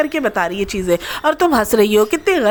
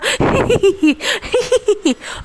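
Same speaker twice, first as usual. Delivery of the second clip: high giggle; laughing; 8 semitones higher than usual. Real, not acted. Vlogger giggles after her jab about constant laughter, high giggle. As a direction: burst into a giggle.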